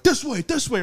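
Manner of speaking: high, urgent soldier's orders, imitated; mock military usher; military command. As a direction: hurry people along like a soldier. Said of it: "greeted, like, by military".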